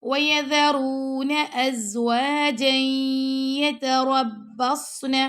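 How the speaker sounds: slow chanted recitation; Quran recitation. Shown as a demonstration. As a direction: draw each word out in full recitation. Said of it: "teacher recites Quran verse".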